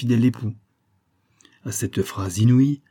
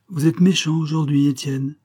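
a mild reproach, voiced higher and louder; reproachful; 7 semitones higher, 4 dB louder than usual. A narrator's reading. Aunt gently scolding the boy who scratched her, reproachful. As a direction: scold him gently, hurt. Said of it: "me fit-elle avec reproche".

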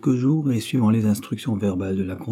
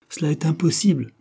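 higher-pitched, mildly agitated protest; agitated exclamation; 7 semitones higher than usual. A narrator's reading. Countess protests her husband's sacrifice, mildly agitated. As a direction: let the refusal burst out, lightly acted. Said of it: "s'écria-t-elle en laissant échapper un mouvement convulsif".

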